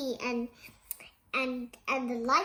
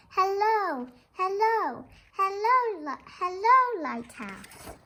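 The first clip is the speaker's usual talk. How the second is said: high, louder repeated hellos; sing-song calling; 7 semitones higher, 4 dB louder than usual. Pretend. Children greet the lighthouse with sing-song calling. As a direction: call out again and again.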